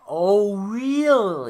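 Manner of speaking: loud, drawn-out mock amazement; sarcastic disbelief. Podcaster mocking an obvious warning, heavily sarcastic.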